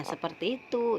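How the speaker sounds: normal talking voice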